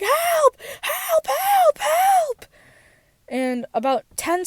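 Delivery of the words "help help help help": high, loud desperate cries for help; retold panicked calls for help, loud and high; screaming for help. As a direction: scream it out like you're stuck and hurt. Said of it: "I start screaming for help".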